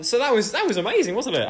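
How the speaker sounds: high, fast laughing delight; giddy, laughing brag. Real, not acted. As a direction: gush through laughter at the joke. Laughing at the prank.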